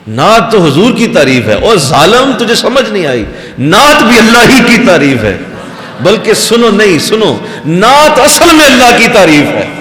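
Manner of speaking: high, loud, impassioned insistence; heated preaching rebuke. Real, not acted. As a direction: hammer the point home at an objector, peaking at the end. Preacher heatedly rebukes an imagined objector. (جوش سے بلند آواز میں ڈانٹتے ہوئے)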